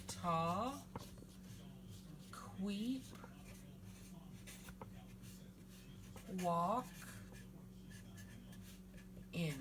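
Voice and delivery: "Ta, queep, walk, in", each syllable alone with long pauses; sounded out. Shown as a demonstration. Teacher sounding out "Ta, queep, walk, in" for learners, syllable by syllable. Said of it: "I'll sound that out for you".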